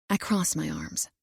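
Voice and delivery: plain reading voice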